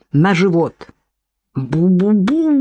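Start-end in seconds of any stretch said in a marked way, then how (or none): 1.5-2.5 muffled creature babble; narrator's lightly voiced dragon; straining dragon cry. A narrator's reading. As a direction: push out the nonsense syllables with mild effort. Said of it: "надсаживался тот".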